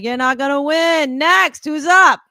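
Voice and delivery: imitating the DJ calling the next contestant; dismissive DJ. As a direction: brush off the loser. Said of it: "He's like".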